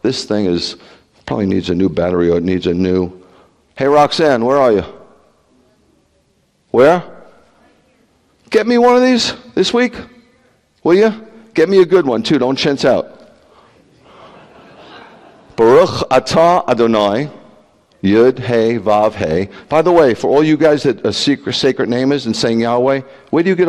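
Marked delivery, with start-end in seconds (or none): 3.8-13.2 calling across the room to Roxanne, higher; calling Roxanne from the pulpit. Real, not acted. 15.5-19.5 Baruch Atah Adonai chanted with care; Hebrew blessing recited. Shown as a demonstration. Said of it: "Let me show you".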